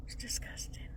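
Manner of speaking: whispered, very soft aside; hushed, voiceless disgust. Real, not acted.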